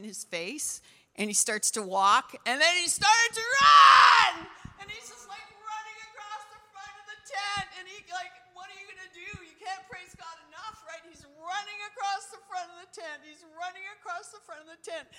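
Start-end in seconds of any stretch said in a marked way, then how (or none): 2.4-4.5 high, loud, drawn-out cry of excitement; shouting at the climax of a miracle story; excited shout. Real, not acted. Climax of healing testimony. 4.8-15.1 high, softer, strained voice after a shout; high-pitched, hushed amazement; overcome with excitement. Real, not acted. Overcome retelling the man running.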